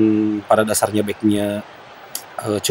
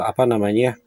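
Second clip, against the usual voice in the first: ordinary talk, nothing marked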